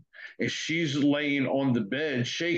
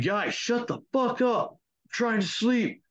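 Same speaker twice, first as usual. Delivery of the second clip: higher-pitched slow yell through the door; retold yell; 11 semitones higher, slower than usual. Yelling at the voices outside his door, annoyed shout. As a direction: snap at the noisy voices, dragging it out.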